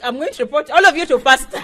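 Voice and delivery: hammed-up kid threatening to tell on everyone; childish tantrum. Triggered woman threatens the ushers, like a child in a tantrum. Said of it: "I became like a child".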